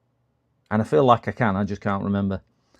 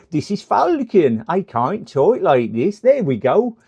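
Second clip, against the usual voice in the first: slow, higher, louder rustic West Country drawl; 6 semitones higher, 6 dB louder, slower than usual. Shown as a demonstration. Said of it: "I'm like one of the Wurzels".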